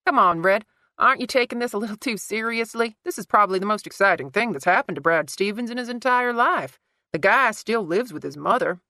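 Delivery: loud, breezy mockery; teasing Hare. A narrator's reading. Hare mocking Sarah for being so serious, loud. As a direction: needle her for taking it seriously.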